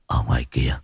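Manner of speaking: hollow, haunted low voice; eerie low murmur. Acted. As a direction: speak as if something else talks through him. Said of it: "Giọng của ông trầm trầm khó hiểu".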